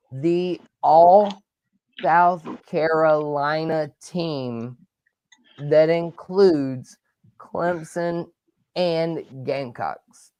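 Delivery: slow, spaced-out announcement; slow, deliberate. Real, not acted. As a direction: spell it out slowly, phrase by phrase. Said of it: "let me say it like this".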